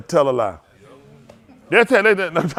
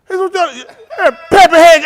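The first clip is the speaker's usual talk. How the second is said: belts out pepper head much higher and louder; loud quote; 13 semitones higher, 12 dB louder than usual. Retold or imitated. Imitating the old man yelling the insult, loud and high. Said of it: "He said".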